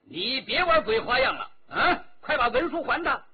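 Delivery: loud, high rebuke; guard scolding the trickster; angry shout. A narrator's reading. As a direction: shout him down. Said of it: "马静霞向矮子喝道".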